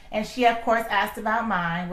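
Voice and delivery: ordinary delivery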